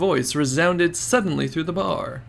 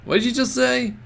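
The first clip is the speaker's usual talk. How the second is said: indignant threat; 11 semitones higher, 4 dB louder than usual. Acted. Susumu offended threat.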